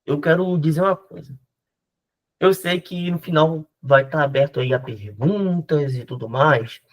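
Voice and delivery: ordinary talk, nothing marked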